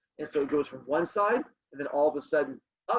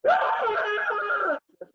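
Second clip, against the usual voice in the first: high, slow startling yell; 3 dB louder than usual. Shown as a demonstration. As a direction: yell to surprise the class. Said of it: "I will do creepy voices".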